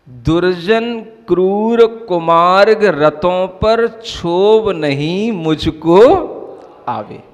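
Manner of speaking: very slow, drawn-out verse chant; verse recitation. Acted. Preacher reciting scripture on the cruel to listeners, slow chanted verse. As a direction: recite it slowly, stretching each word of the verse.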